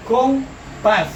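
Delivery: "Compás" split as com, pás; separado en sílabas. Shown as a demonstration. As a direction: count out "Compás" in two separate syllables. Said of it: "¿Cuántas sílabas tiene compás?". ("Compás" dividido con pausa: com, pás)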